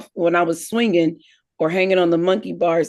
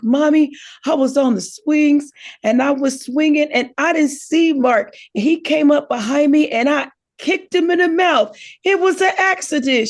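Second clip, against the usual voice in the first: put-on higher, rising sing-song child's plea; innocent little girl; 8 semitones higher than usual. Girl telling Mommy the kick was an accident, innocent child voice. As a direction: play the sweet innocent to dodge blame. Child lies to her mother.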